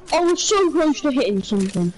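ordinary talk, nothing marked

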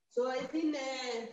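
very quiet, far from the mic; speaking too softly to hear; barely audible. Real, not acted. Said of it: "Your voice is very low".

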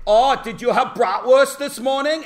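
put-on loud, high German accent; hammed-up German accent, cheerfully asking; mock German accent. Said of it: "And you could say".

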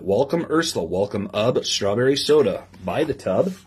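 lively storybook reading to a class; reading to children. Acted. Teacher reading a picture book to her class, lively storybook voice. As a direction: read the rhyme aloud with bounce for the kids.

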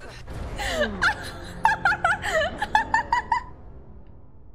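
squealing high laughter; high laughing fit. Real, not acted. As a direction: dissolve into giggles. Laughing at broken game.

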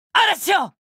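very high, soaring call to the storm; triumphant incantation yell; summoning shout. Acted. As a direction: unleash the storm with a cry. Elemental burst cry.

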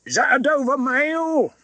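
high, slow call from a distance; calling from afar. A narrator's reading. Rider calling to the coach from the mist, distant call. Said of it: "a man's voice called from the mist".

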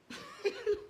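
high, soft laugh; short high-pitched chuckle; mocking laugh. Real, not acted. Laughs mockingly at the person addressed.

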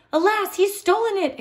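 the Baron exclaims that the fraction is stolen; exclaiming. A narrator's reading. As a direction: burst out in shocked dismay. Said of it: "exclaimed Baron von Mathematique".

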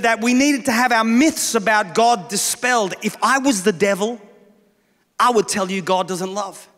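ordinary delivery

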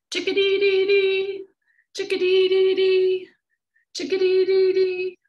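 high bright bird call; chickadee call. A narrator's reading. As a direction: chirp it like a tiny bird. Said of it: "Some birds even say their name".

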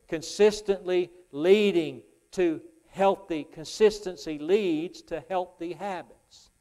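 slow, loud emphatic preaching; deliberate pulpit emphasis. Acted. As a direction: spell out the lesson slowly. Preaching, slowed.

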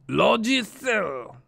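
"Logiciel" said in French, slow, high and loud; French word. Shown as a demonstration. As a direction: draw "Logiciel" out slowly with French sounds. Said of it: "I'm gonna say the word".

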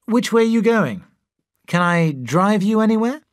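proud host offering a lift; proud of his new car to Freddie; proudly offering. A narrator's reading.

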